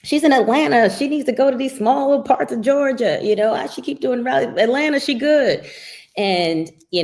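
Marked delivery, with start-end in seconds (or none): 0.0-5.6 high, loud mock critic voice; voicing the critics with a high loud sneer. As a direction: parody the critics in a raised voice. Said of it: "They were saying".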